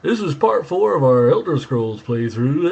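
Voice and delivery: loud, high, drawn-out announcer parody; mock fake radio inflection, belted out slowly; fifties radio voice. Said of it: "nineteen fifties radio voice with this fake inflection".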